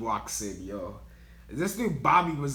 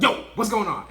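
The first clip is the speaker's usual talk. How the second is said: sudden loud, higher call-out; mock startled supervisor; 7 semitones higher, 6 dB louder than usual. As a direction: imitate a boss catching someone out. Acts supervisor discovering him.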